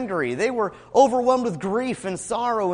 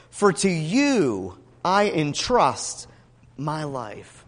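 slow, measured psalm reading; scripture reading; 4 dB louder, slower than usual. Retold or imitated. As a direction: read it as a deliberate prayer of trust. Reads psalm verse aloud.

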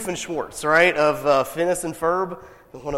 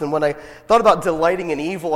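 ordinary delivery

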